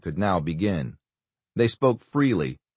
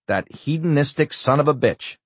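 higher, cursing in disgust; swearing angrily; 7 semitones higher than usual. Acted. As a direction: spit the insult out. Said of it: "swore Rolander".

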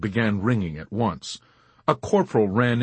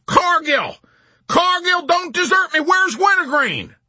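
high, loud desperate shouting; shrieking, desperate general; panicked plea; 7 dB louder than usual. A narrator's reading. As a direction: yell for help in a panic.

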